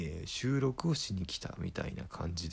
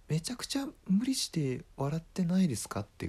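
usual speaking voice

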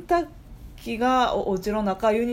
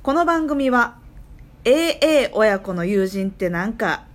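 sponsor announcement; 3 semitones higher, 4 dB louder than usual. Acted. Host reads the sponsor credit to listeners, lightly formal.

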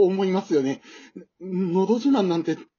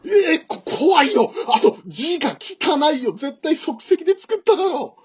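frightened, higher, louder stammering complaint; scared outburst; 6 semitones higher, 4 dB louder than usual. Acted. Nervous contestant seeing family's banner, spooked high outcry. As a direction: recoil from the creepy banner. Wife's relatives wave eerie banner.

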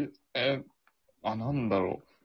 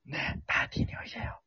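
soft unvoiced whisper; soft spa whisper; 10 dB quieter than usual. Shown as a demonstration. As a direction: whisper the invite gently. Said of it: "もうちょっとキムタクイメージした方がいいな".

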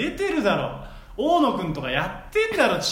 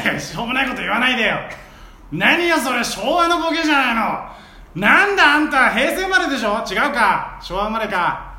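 loud brassy auntie, mocking; sassy onee scolding the joke; loud nosy osaka auntie; 3 semitones higher, 5 dB louder than usual. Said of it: "お姉ツッコミ".

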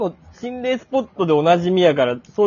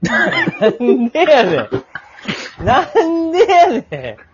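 slow, laughing, much higher "なんでやねん"; laughing protest; 8 semitones higher, 5 dB louder, much slower than usual. Real, not acted. Cohost laughing at the wife's refusal, slow and high. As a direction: protest through laughter, dragging it out.

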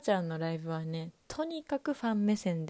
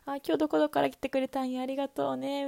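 imitating the singer; 7 semitones higher than usual. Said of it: "みたいな".

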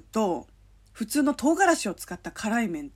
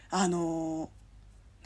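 usual speaking voice

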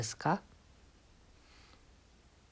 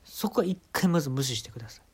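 ordinary talk, nothing marked

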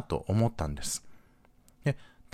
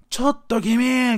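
imitating an indignant older man's shout, high and drawn out; retold shout; shouted call-out; 12 semitones higher, 5 dB louder, much slower than usual. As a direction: call out loudly and high, stretching the words like a scolding stranger. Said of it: "って叫んだのよ".

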